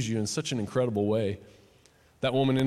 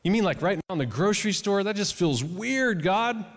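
higher-pitched reluctant pleading; arguing with God; 7 semitones higher than usual. Retold or imitated. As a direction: push back in mock disbelief, pitched up. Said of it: "I was kinda arguing with God".

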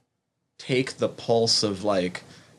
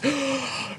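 sharp high gasp of recognition; sudden fan gasp; excited gasp. Retold or imitated. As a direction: gasp as if spotting someone famous. Acting out first-time recognition.

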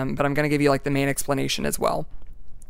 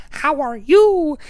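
much higher and louder slow bird squawk; loud high crow imitation; talking crow, louder; 13 semitones higher, 7 dB louder, slower than usual. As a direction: belt the greeting like the crow. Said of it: "There's this one crow that says".

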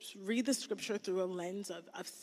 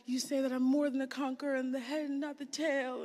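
pleading prayer; 7 semitones higher, 6 dB louder than usual. Retold or imitated. Retold prayer to God.

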